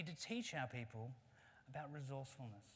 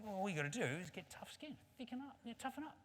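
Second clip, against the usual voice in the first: rhino's advice, pitch climbing high; advising rhino; 7 semitones higher than usual. Acted. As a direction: coach the monkey in a raised rhino voice. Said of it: "He said".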